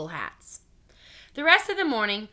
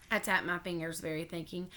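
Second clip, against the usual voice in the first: ordinary delivery, nothing marked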